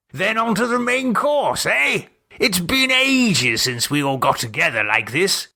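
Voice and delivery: rally the party; cheery Gerald. Acted. Gerald urging friends to the main course, jolly.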